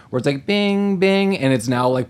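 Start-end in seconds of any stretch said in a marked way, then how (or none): 0.5-1.4 video game bing. Retold or imitated. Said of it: "enacted telepathic mode".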